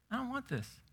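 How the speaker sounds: put-on high, soft child's whine; a picky kid turning down lunch; whiny kid. Child refuses mama's lunch with a high soft whine.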